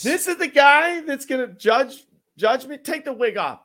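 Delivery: high, loud disbelief; can't believe it, squeal it out; incredulous outburst. Real, not acted. Outraged at judge wigs.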